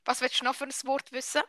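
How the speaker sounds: quick Swiss German question; fast Swiss German. Shown as a demonstration. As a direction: repeat it briskly in Swiss German dialect.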